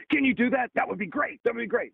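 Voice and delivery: light up like a fan who just got a favor; eager Tom Hanks. Retold or imitated.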